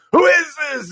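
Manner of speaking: high, loud mock teen demand; suspicious girl. Lori answering a weird phone call, high loud mock suspicion. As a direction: pitch way up and snap the question. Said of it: "she's like".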